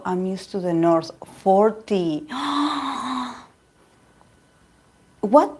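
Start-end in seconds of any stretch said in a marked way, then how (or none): 2.3-3.3 long astonished gasp; shocked gasp. Real, not acted. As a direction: suck in air, astonished. Reacting to forty degree heat.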